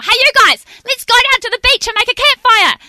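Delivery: high, loud holler across a campsite; yell. Retold or imitated. Young camper calling to friends down the campground, yelling. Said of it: "we heard someone yell".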